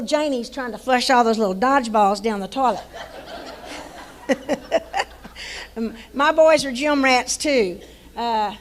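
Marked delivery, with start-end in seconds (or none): 2.9-5.7 breathy laugh at the memory; a soft amused laugh; airy laugh. Real, not acted. Coach remembering little Janie's prank, soft laugh.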